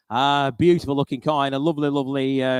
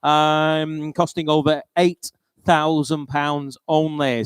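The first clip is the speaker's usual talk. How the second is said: normal talking voice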